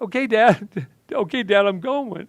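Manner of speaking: put-on a terrified boy shouting back to his father; scared scream. A narrator's reading. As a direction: cry out in terror while backing away. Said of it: "I screamed out".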